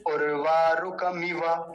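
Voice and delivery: उर्वा रुकमिव said slowly with short vowels; recited slowly. Shown as a demonstration. Teacher drilling the chant, slow and careful. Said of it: "स्वर खींचा नहीं जाएगा".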